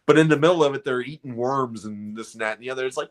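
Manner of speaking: normal talking voice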